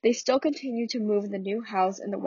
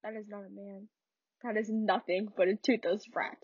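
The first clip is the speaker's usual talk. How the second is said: much softer, disgusted mother; Mama's quiet contempt; 8 dB quieter than usual. Acted. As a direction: murmur the verdict on her son. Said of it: "I'm not good at accents".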